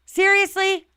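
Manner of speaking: high, loud disbelieving outburst; incredulous seriously. Real, not acted. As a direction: throw it up high, can't believe it. Disbelief at the mother's Starbucks trip.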